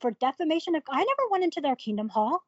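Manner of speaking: high-pitched outraged protest; retold indignation. Telling brother about elders' false claim, indignant protest.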